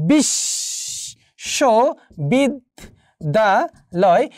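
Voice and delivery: বিশ্ববিদ্যালয় very slowly, split into five syllables with pauses; বিশ্ববিদ্যালয় drawn out, each syllable standing alone. Shown as a demonstration. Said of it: "এই পাঁচটি অক্ষর রয়েছে".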